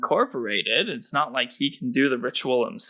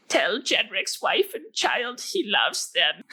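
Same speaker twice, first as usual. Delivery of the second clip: dying man voice; 10 semitones higher than usual. Acted. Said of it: "Jedrick goes".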